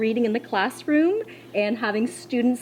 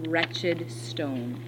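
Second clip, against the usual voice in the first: soft repeat; 4 semitones lower, 5 dB quieter than usual. Shown as a demonstration. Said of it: "What other word could be used".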